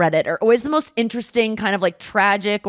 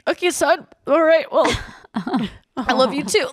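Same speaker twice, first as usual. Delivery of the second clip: higher, halting voice of a moved dad; touched dad; 5 semitones higher than usual. Retold or imitated. Dad answers his kid's loving call, imitated as touched. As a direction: play a dad awkwardly saying it back.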